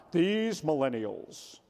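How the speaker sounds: a hint of a cranky older manager; mock complainer. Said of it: "I hear people say all the time".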